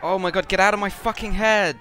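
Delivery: loud, high panicked shout; panicked yell. Real, not acted. Panicked yell in horror game.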